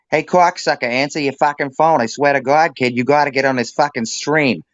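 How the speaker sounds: brash Boston call to a buddy, swearing; Boston accent. Retold or imitated. To Gianetti on stream, a Boston accent rant. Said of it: "I will say this in his native tongue".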